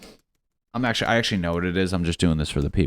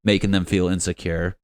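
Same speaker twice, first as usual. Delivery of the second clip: normal talking voice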